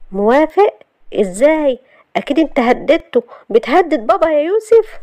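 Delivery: high, accusing questions; incredulous. Acted. Shahd suspecting Youssef threatened her father, incredulous. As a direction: press in disbelief, voice climbing.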